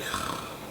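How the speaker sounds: long unvoiced sigh of relief. Told the songs are mixed, a long relieved sigh. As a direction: let all the tension out in one breath. Hearing everything is fine.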